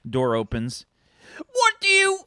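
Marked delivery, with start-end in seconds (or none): 1.5-2.2 high, loud snap cut short; annoyed mum. Acted. Mum opening the door to snap at the visitor, high and loud. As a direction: start telling off then stop dead.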